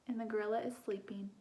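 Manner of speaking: quick hushed whisper; storyteller whispering so the gorilla sleeps. Acted. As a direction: whisper so you don't wake him.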